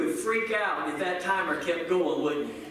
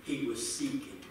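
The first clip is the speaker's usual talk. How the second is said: quiet earnest preaching; 9 semitones lower, 9 dB quieter than usual. Acted. Preacher urging the congregation to seek God: hushed, earnest.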